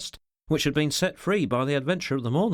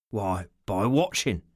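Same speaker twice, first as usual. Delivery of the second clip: slow, lower, deliberate; slow answer; 5 semitones lower, slower than usual. A narrator's reading. Ricardo reveals his plan to his boss, answering slowly. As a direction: draw the words out thoughtfully. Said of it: "Ricardo answered slowly".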